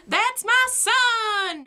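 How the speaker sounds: a hammed-up Southern dad bragging about his son; mock Southern accent. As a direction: do the proud dad in an exaggerated Southern accent, slow and way up high. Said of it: "My father speaks in a southern accent?".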